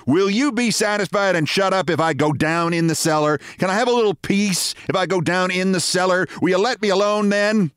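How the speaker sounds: a fed-up wife pleading to be left alone; exasperated wife. Acted.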